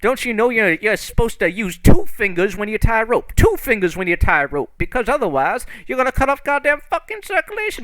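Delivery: high, loud, exasperated schooling; acted irate instruction; scolding lecture. Character berates man about knots.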